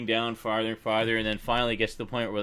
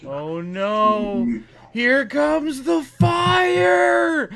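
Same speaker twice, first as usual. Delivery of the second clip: put-on high, loud, drawn-out child's wail; kid's panicked cry; 8 dB louder, much slower than usual. Dad imitating his toddler panicking at the movie fire. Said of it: "she just blurts out".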